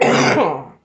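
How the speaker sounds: loud cough into a gasped "Oh"; real coughing fit. Real, not acted. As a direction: cough hard, then catch yourself. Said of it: "Still got a little bit of that covid".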